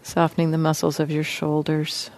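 soft, even voice with long pauses; guided meditation. Real, not acted. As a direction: gently guide listeners into relaxing, leaving space between phrases. Leads a guided body-scan meditation.

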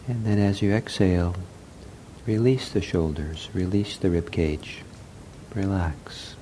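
soft, unhurried meditation voice with long pauses; guided meditation. Real, not acted. Leads a breathing meditation.

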